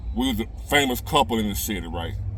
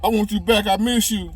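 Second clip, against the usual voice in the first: mock crying plea; 9 semitones higher than usual. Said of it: "crying so hard".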